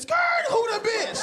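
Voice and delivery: high skid noise into nosy question; screeching halt. Acted. Bike rider minding his business stops short, high nosy cry. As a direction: screech to a stop, then ask high-pitched.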